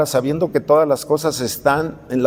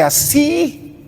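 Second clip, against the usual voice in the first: emphatic high así; 11 semitones higher than usual. Shown as a demonstration. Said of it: "así se los dije".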